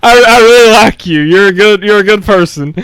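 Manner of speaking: mock flattery. Playful flattery of the absent coach.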